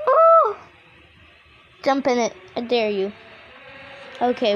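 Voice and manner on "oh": high gasp into loud yelp; startled gasp. Real, not acted. Player startled by the game, gasp and yelp. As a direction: gasp sharply and cry out.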